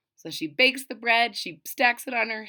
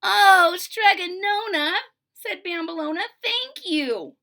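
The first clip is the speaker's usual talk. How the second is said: loud, much higher gratitude; thrilled daughter; 8 semitones higher, 8 dB louder than usual. Acted. Girl thanking the witch, loud and high. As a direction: gush with thanks.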